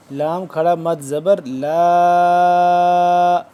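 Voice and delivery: لا stretched four to five alif counts; long madd on la. Shown as a demonstration. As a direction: hold the لا long, slow and drawn out. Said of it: "چار سے پانچ الف کے برابر اس کو لمبا کریں گے". (لا کو چار سے پانچ الف تک کھینچیں)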